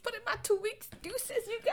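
way up high, squeaky; high-pitched voice. Acted. High mock voice quote.

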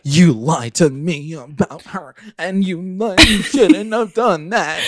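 slow, high-pitched tearful scolding; hammed-up wailing accusation; mock angry mother. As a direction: act out a pissed-off mom, drawn out. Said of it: "She's so pissed".

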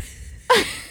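high laugh cutting off the reading; helpless laughing. Real, not acted. Host losing it over a listener's story, helpless laughing. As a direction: crack up mid-story. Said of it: "I can't even read it without laughing".

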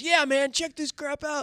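high bragging inmate. Retold or imitated. The inmate boasts about the car, in a high voice. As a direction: brag like the car borrower. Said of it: "He goes".

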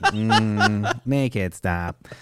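slow robot crying oil; deadpan robot, slow. Pretend. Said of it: "I don't want my robot voice".

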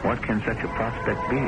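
ordinary talk

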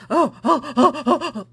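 hammed-up imitation of a flustered school board; mocking school board. Host mocks the school board's flustered reaction. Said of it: "School board's like".

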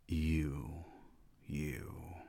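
soft unvoiced inner thought; inner whisper. Narrator hushes his own unspoken thought. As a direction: breathe it as a silent thought.

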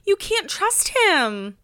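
high, drawn-out cast member impression; Alex H. Podcaster mimics cast member for listeners, high drawn-out impression. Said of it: "in Alex H's voice".

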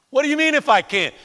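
loud, high, fast retort; irritated. Acted. Jesus asking the father about his doubt, irritated. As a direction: snap the doubt straight back. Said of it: "He's still a little irritated".

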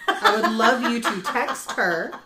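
laughing while asking. Real, not acted. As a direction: giggle through the request. Laughing request to listeners.

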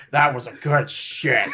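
low, laughing impression; imitating Tourette's guy. Friend imitating a video character's reply, laughing impression. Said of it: "You make him sound like Kevin from The Office".

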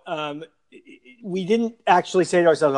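ordinary talk

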